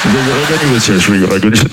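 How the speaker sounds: ordinary talk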